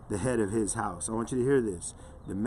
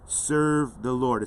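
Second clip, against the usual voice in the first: SERVE stretched out slowly and firmly; emphatic; 4 dB louder, much slower than usual. Shown as a demonstration. Preacher reading Joshua's command to listeners, emphatic. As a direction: lean hard and slow on "Serve the Lord". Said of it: "It's emphatic".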